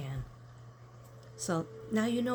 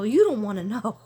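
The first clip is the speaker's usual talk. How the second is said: laughing reply; 3 semitones higher, 9 dB louder than usual. Retold or imitated.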